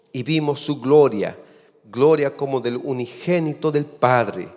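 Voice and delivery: slow, weighty reading of the verse; solemn scripture reading. Acted. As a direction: proclaim the Bible text slowly. (lectura solemne de la Escritura)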